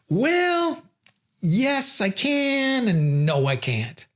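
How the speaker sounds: high, teasing yes then falling no; sing-song yes, dropped no; playful contradiction. Shown as a demonstration. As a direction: toy with them, then shut it down. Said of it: "using contradictions".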